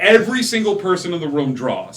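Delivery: storyteller kicking off a bar brawl, excited; excited narration. A narrator's reading. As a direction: burst into the action, building hype. GM narrates fight erupting.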